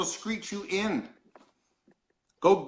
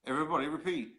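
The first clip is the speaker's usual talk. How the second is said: usual speaking voice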